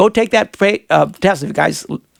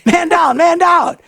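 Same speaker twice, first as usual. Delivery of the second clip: high mock shout of alarm; funny call as the body slides out; 10 semitones higher, 4 dB louder, slower than usual. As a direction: call it out like a soldier, joking. Joking alarm at funeral.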